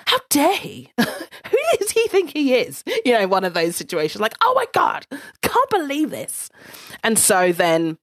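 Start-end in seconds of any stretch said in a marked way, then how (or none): 0.1-2.8 high-pitched mock outrage, half laughing; fuming, played up with a laugh; retold seething. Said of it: "I was fuming. I was seething". 4.4-6.5 high-pitched exasperated disbelief; mock-exasperated, much higher voice; retold fuming. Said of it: "I was fuming. I was seething".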